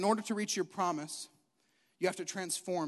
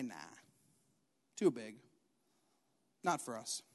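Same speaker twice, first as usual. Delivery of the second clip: dismissive refusal; 8 semitones lower, 8 dB quieter than usual. Acted. Voicing the spies who refuse.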